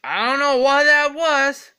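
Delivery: high, loud put-on heckler; mock heckler. Put-on listener mocking the singing, high and loud. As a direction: react to the bad song in a put-on voice.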